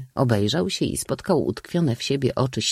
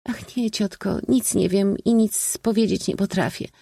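high-pitched timid refusal; frightened; 11 semitones higher than usual. A narrator's reading. Niece backing away from her aunt's question, frightened. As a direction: shrink back in a high girlish voice. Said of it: "wylękła".

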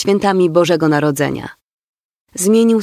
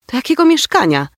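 shocked exclamation; 7 semitones higher than usual. A narrator's reading. Mother blurts out in shock over the flat, high exclamation. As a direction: pitch jumps higher, startled question. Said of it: "wykrzyknęła jej mama".

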